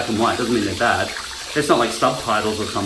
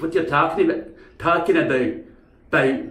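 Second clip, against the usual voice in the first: raised Scottish "about" demonstration; Scottish accent; 4 semitones higher than usual. Shown as a demonstration. Speaker demonstrating "talking about", Scottish accent. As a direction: stress the Scottish vowel in "about". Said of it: "And that house thing".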